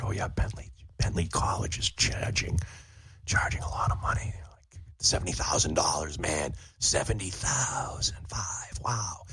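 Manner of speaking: hushed whisper, slowing down; whispered aside. Shown as a demonstration. Host whispering the college prices to listeners. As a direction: confide it in a whisper. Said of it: "and whisper".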